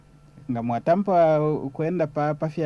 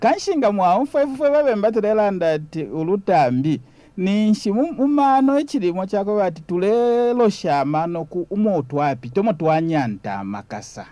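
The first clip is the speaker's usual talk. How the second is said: animated; 7 semitones higher, 4 dB louder than usual. Real, not acted. Excited explanation, +7 st.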